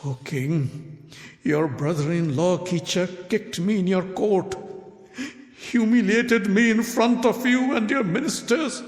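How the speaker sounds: high, rising outraged plea; cracked angry voice. A narrator's reading. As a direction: demand justice, voice cracking. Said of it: "With a cracked voice".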